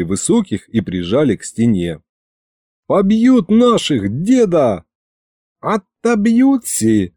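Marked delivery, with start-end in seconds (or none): 2.9-4.8 high, drawn-out worried plea; alarmed cry. Acted. Boy alarmed watching the battle. 5.6-7.1 slow, stretched, higher-pitched reassurance; drawn out, unsure. A narrator's reading. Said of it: "протянул он, но как-то неуверенно".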